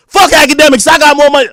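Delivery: loud, high shouted rap line; mock rapper outburst. Rapper retaliating in the booth, mock outburst. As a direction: blast it out like an indignant rapper. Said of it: "He goes in the booth".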